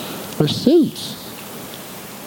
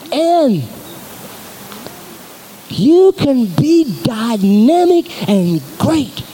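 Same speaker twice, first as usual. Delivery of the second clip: slow, drawn-out urging; 4 semitones higher, much slower than usual. Real, not acted. Pastor to congregation, slow drawn-out urging. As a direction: stretch every word slowly, building to a rise.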